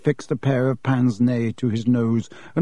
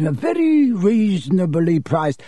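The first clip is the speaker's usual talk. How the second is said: oily shopkeeper; 5 semitones higher than usual. Acted. Shopkeeper Borgin pitching a sale.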